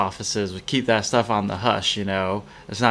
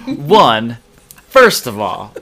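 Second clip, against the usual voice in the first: loud, emphatic objection; worked-up excitement; 8 dB louder, much slower than usual. Real, not acted. Co-host objecting to an office gift pool, worked up and loud. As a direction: punch out each point, excited and loud. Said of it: "I'm getting a little excited here".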